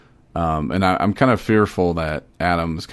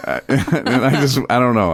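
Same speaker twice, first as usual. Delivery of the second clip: laughing; 7 semitones higher, 6 dB louder than usual. Real, not acted. Laughing over the name.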